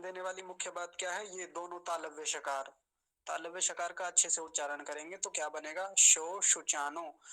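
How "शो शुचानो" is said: शो शुचानो with both as palatal sh, louder and slow; palatal sh clearly. Shown as a demonstration. Teacher stressing the correct sh for the class. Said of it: "अब स नहीं बोलेंगे".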